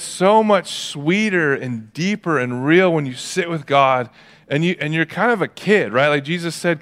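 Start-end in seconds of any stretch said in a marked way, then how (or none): none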